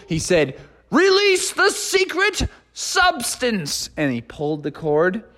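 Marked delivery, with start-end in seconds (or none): 0.9-3.9 high, slow, dramatic proclamation; bullhorn shout. Acted. Froggy on his bullhorn ordering the plane, slow high proclamation. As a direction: boom it out like a grand command. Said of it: "shouted up to the plane".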